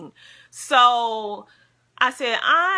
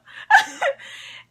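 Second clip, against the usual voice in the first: high, breathy giggle; relieved laugh; 4 dB louder than usual. Real, not acted. Vlogger laughing that Tara ugly cried too. Laughing, not alone in crying.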